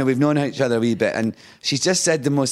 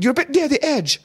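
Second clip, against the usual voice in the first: mock warning. Older co-star warns him as he drives, high mock warning. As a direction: pitch it right up to warn the driver.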